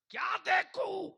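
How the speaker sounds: very high, loud retort; shouted challenge. Acted. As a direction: throw the question out at full voice. Preacher repeats question at full force. (ऊँची आवाज़ में ललकारता सवाल)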